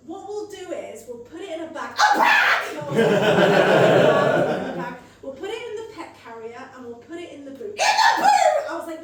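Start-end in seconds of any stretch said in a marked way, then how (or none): 1.9-4.9 loud, laughing disbelief; mocking her own plan to bag the pet, loud; incredulous shout. Real, not acted. 7.8-8.7 loud, laughing disbelief; mocking her own plan to bag the pet, loud; incredulous shout. Real, not acted.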